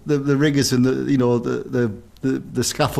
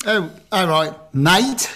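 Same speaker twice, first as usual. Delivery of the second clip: mock matey downgraded posh accent; 4 semitones higher, slower than usual. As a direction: lightly imitate a posh accent trying to sound common. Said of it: "posh people who try to downgrade their accent".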